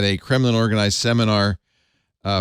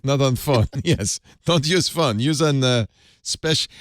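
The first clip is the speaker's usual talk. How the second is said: put-on higher, broken-English Russian accent; 3 semitones higher than usual. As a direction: play along in a hammy Russian accent. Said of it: "We're all doing bad Russian accent".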